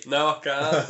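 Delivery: usual speaking voice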